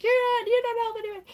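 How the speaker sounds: fast, very high mock scolding; imitated riffing. Tanya riffs on both flirting men, mock scolding. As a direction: rattle it off high and loud, imitating. Voices her riffing on the suitors.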